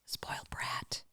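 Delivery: breathy whisper under the breath; whispered spoiled brat; whispered aside. Real, not acted. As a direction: whisper it fondly to the dog.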